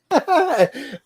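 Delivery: short high-pitched laugh; high giggle. Real, not acted. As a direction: giggle at your own joke. Laughs after Iron Man line.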